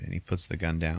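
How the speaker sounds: ordinary delivery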